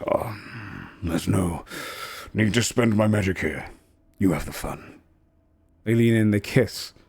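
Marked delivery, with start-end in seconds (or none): 0.1-5.0 low, soft, sinister vampire voice; vampire husband. Acted. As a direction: purr it lazily, amused and cold. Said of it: "He says".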